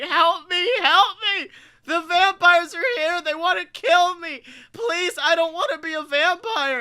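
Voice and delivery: high, loud mock plea for rescue; panicked victim. Scare actor begging a guest for help from vampires, hammed-up panic. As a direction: imitate a terrified damsel begging for her life. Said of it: "And she's like".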